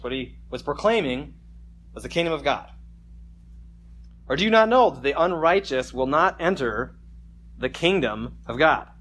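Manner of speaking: ordinary talk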